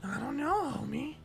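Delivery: high, hammed-up Marge try; a hint of Marge; Marge voice attempt. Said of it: "I can't do it".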